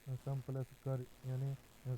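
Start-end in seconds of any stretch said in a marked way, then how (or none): none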